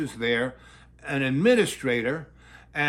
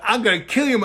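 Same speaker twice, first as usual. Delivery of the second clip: loud, higher threatening yell; mock threat; 7 semitones higher, 7 dB louder, faster than usual. Cop father yelling at the nun, loud mock threat. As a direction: shout the threat at her.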